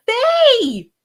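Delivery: high, drawn-out call of a name; excited greeting. Real, not acted. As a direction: sing out a loud hello to an arriving friend. Greeting a friend who arrives.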